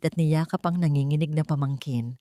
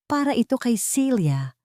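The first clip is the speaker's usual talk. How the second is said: usual speaking voice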